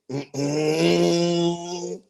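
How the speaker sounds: long, droning engine imitation; scooter motor hum. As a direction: hum a small engine straining along.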